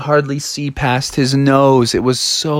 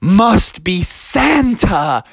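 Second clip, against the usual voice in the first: slow, higher, drawn-out wonder; awed realisation; 7 semitones higher, much slower than usual. Acted. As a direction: let the discovery dawn slowly. Kids realise it is Santa.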